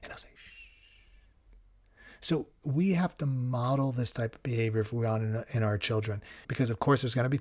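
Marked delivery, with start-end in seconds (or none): 0.4-1.9 long, very quiet "shh"; imitated hushing sound; soft shush. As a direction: breathe out a gentle shush. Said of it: "putting the finger over the mouth".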